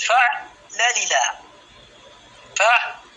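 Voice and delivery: "فعلل" slowly with an open long -a ending; open final vowel. Shown as a demonstration. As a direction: break "فعلل" into syllables, finishing on "laa". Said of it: "وأطلق الألف من أجل القافية".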